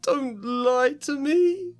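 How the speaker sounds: put-on high, slow, tearful ghost girl; hurt and near tears, slowed down; tearful gasp. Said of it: "Myrtle gasped".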